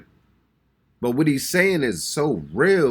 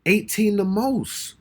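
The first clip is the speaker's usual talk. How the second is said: amazed; 10 semitones higher, slower than usual. Real, not acted. Stunned by Dave's age.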